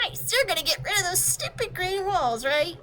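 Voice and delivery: put-on high, fast, offended kid voice; indignant girl. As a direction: snap back at the pompous clock, pitched high. Girl character asks eagerly.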